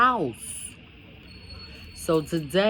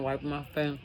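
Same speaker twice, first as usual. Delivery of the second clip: usual speaking voice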